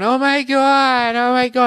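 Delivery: high, slow, wailing oh my God; loud, high mock panic; mocking hysterical girl. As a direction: ham up a shrieking girlfriend, dragging it out. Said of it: "Jersey Shore girl's going".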